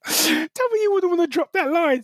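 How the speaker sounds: high, laughter-lifted delivery; amused exclamation. Real, not acted. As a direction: say it straight out of a laughing fit. Laughing over the cheesy line.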